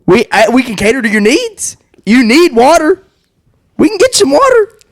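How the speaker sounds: high, teasing sing-song; mocking high voice. Real, not acted. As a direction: tease him in a high voice. Teasing the guest about drinks.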